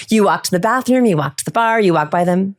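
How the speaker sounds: normal talking voice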